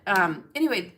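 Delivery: ordinary delivery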